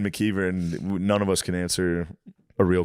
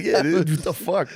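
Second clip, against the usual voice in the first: laughing retort; 9 semitones higher than usual. Retold or imitated. Retold reply, laughing.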